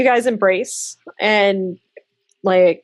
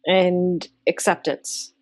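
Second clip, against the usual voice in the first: soothing narration; 4 semitones lower than usual. Acted. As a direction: ease them into a sense of comfort. GM describes comforting warmth.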